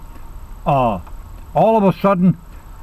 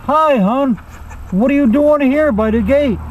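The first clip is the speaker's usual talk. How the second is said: higher-pitched put-on wife voice; wife's greeting; 8 semitones higher than usual. Wife greets husband at the gate.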